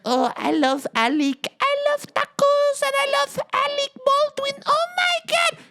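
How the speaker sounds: high squealing mock Spanish accent, far above normal; mock fake accent. Mocking the wife's fake Spanish accent, high and hammed-up. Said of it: "And she's like".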